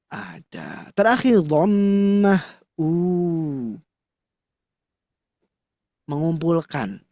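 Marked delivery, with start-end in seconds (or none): 0.9-3.7 u with lips gathered forward, slow; rounded u. Shown as a demonstration. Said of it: "Bibir dikumpulkan".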